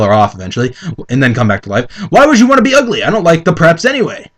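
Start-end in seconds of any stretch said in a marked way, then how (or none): 2.1-4.3 lightly voiced sneering Draco, pitched higher; Draco's line. A narrator's reading. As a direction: scoff at the preps. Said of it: "answered Draco".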